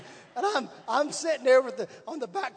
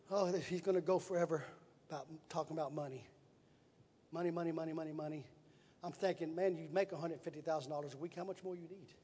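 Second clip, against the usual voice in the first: softer, lower private thought; inner grumble; 5 semitones lower, 10 dB quieter than usual. Retold or imitated. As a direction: roll your eyes at the money talk, half under the breath. Private thought during boss's talk.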